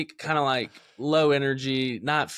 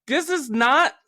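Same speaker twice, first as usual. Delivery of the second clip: high, louder alarmed protest; alarmed disbelief; 10 semitones higher, 4 dB louder than usual. Retold or imitated. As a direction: sound shocked at your own body. Retold alarmed self-quote.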